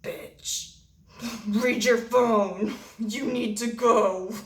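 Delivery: slow, drawn-out mock hint to a friend; exaggerated, slow get-the-message delivery; pointed hint. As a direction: stretch it out like a hammed-up secret signal. Mimes a pointed signal to her friend.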